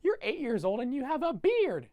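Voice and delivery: put-on high, loud taunting kid voice; mocking bully. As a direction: sneer it up high like a schoolyard bully. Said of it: "Augustus going".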